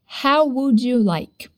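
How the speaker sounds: slow, every word spaced out; slow drill. Shown as a demonstration. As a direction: say it slowly for the learner. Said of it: "One more time".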